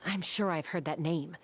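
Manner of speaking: usual speaking voice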